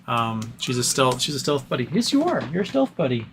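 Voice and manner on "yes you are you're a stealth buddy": pet talk. Real, not acted. Praising the dog.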